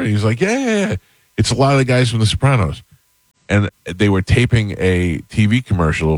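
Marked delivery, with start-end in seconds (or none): 0.4-2.8 much higher, insistent mock "yeah"; eager reassurance. Actor friend assures hesitant storyteller about dinner guests, eager reassurance. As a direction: imitate a friend waving off any doubt. Acting out Vinny brushing off doubt.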